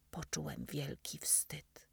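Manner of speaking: usual speaking voice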